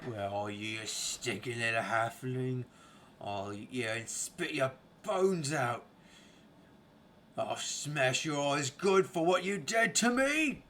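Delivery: growing from threat into a howl; deep roaring ogre. Acted. Game master voicing the ogre threatening a halfling, roaring and howling. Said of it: "A deep voice roaring and howling".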